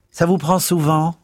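high, loud disapproving charwoman; Mrs Biggs voice. A narrator's reading. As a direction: sniff it out high and prim.

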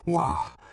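put-on high loud drunken groan. A narrator's reading. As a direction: groan it out half asleep. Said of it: "gémissait celui-ci".

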